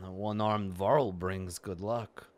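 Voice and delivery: ordinary talk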